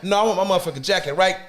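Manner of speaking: fast, rushed, a mock heated demand; rapid retold demand. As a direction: rattle it off, fed up and insistent. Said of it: "retold argument over jacket".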